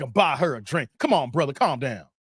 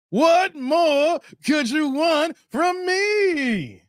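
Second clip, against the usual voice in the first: long, drawn-out shout of exasperation, higher; exasperated shout; 6 semitones higher, much slower than usual. Real, not acted. Streamer pleading with teasing chat, slow drawn-out shout. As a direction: wail it out slowly like he has given all he can.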